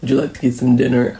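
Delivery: deep smooth voice. Retold or imitated. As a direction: try a deep romantic invite and fumble. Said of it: "call and say".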